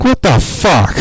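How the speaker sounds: high incredulous outburst; disbelief. Retold or imitated. Watching the mouse delete his files, high shocked disbelief. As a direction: pitch it up in shock.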